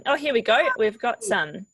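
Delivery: usual speaking voice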